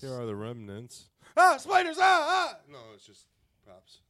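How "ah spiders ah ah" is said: scream. Pretend. Fake scare at spider props.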